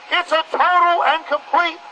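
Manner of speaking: rally the crowd through a megaphone, full force; fiery street-corner sermon through a bullhorn; megaphone protest speech. Real, not acted.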